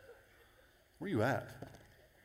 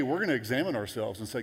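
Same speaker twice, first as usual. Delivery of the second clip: ordinary talk, nothing marked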